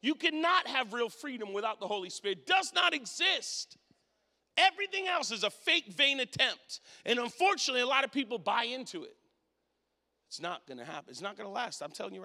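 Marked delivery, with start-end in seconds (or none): 0.0-9.2 forceful preaching. Real, not acted. Preacher warning the congregation about fake freedom, forceful preaching. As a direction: preach it hard to the room.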